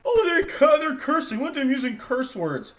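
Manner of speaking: high, loud pearl-clutching mock gasp. Mocking the Christians who only hear the cursing, high hammed-up outrage. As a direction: ham up a scandalised churchgoer.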